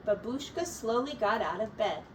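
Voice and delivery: usual speaking voice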